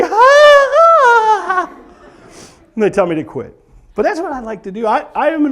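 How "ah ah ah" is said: high shouted screams with laughter; comic panicked shriek; patients screaming. Retold or imitated.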